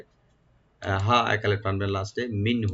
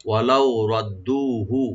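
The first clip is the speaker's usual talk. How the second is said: slow recitation; 4 dB louder, slower than usual. Shown as a demonstration. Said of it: "ওয়া open".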